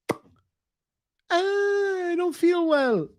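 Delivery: very high, slow feeble whimper, put on; drawn-out mock whine. Streamer mocking the so-called alpha male, long high whine. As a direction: stretch it out like a pathetic complainer.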